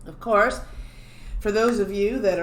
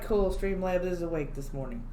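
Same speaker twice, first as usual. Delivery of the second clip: usual speaking voice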